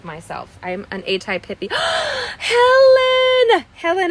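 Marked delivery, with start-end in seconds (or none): 1.7-2.3 sharp, very high gasp of surprise; delighted gasp. Real, not acted. Spots a friend in the chat. 2.4-4.1 loud, very high happy call of a name; excited shout. Real, not acted. Greets a friend in the chat.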